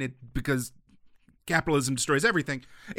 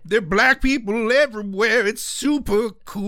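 mock [name]; 4 semitones higher, 4 dB louder than usual. Parodying the music video.

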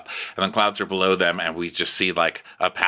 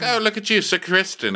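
high, teasing salute; mock greeting; 12 semitones higher than usual. Prince needling a knight, mock greeting. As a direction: pitch it high, toying with him. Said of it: "So it's like".

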